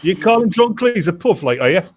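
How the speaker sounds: playfully indignant, pitch jumping up; mock indignant challenge. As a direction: challenge them as if affronted, in jest. Mock challenge to friend's insult.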